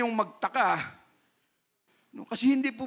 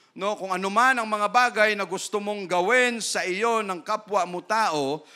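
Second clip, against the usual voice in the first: usual speaking voice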